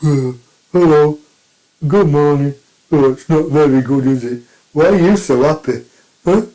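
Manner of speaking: loud, put-on sour grumble; grumpy neighbour voice. Mister Plod sourly greeting a cheerful walker, loud grumpy voice. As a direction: play the miserable neighbour moaning at you. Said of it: "Mister and Missus Plod are like".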